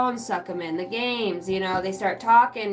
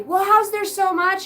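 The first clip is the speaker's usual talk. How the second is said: put-on higher, louder, drawn-out kid question; whining kids; 9 semitones higher, 5 dB louder than usual. Parent mimicking kids about the laundry, whining. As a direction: imitate a baffled kid, pitched up.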